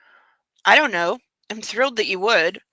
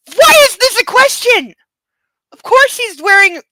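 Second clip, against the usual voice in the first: shrill high indignant rant; high, loud incredulous outrage; outraged; 12 semitones higher, 9 dB louder than usual. Real, not acted. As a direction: scold the book. Outraged reaction.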